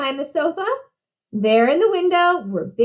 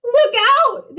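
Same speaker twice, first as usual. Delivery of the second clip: high, louder cry of alarm; warning shout; 10 semitones higher, 5 dB louder than usual. A narrator's reading. Two pigs reach their brother's brick house, high warning shout. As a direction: yell it high and urgent. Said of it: "they shouted".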